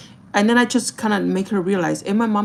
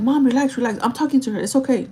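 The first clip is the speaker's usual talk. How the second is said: raised, higher plea to calm down; retold urging; 5 semitones higher, 3 dB louder than usual. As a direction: act out stopping an arguing mom. Acts out telling mom to back off.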